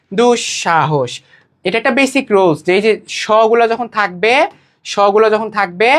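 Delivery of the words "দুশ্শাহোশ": দুঃসাহস drawn out slowly as "dush-shahosh"; slow, lower দুঃসাহস with clear "sh" sounds; palatal sh sounds. Shown as a demonstration. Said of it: "তালব্য স নিব". (ধীরে, তালব্য শ দিয়ে দুঃসাহস)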